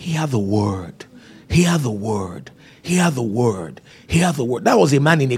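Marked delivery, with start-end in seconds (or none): 0.0-3.8 slow, soft, deliberate repetition; solemn slow refrain; slow emphasis. Acted. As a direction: press each word slowly. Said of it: "preacher repeating slowly".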